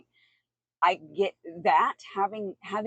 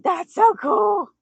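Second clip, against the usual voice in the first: high, loud, drawn-out mock shout; excited retelling; 12 semitones higher, 9 dB louder, much slower than usual. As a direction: imitate your own thrilled outburst, slow and high. Retelling their own delighted reaction.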